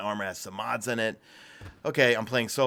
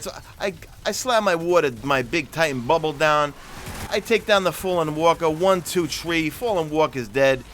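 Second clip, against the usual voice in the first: loud, raised, hammed-up New York bragging; turned-up New York accent; 5 semitones higher, 6 dB louder than usual. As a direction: belt out the story in thick New Yorkese. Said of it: "really turn up the New York accent".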